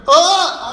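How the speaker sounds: high, loud scream. Retold or imitated. Mister Kent screaming on coaster drop, loud scream. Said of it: "He's screaming".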